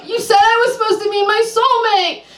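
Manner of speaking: high, indignant complaint; accusing client. Acted. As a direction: blame the psychic outright.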